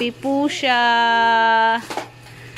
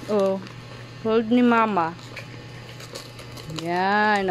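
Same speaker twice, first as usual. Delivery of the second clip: usual speaking voice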